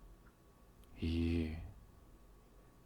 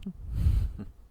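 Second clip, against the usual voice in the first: high giggle; 13 semitones higher, 6 dB louder than usual. Acted. Amused laugh at the partner.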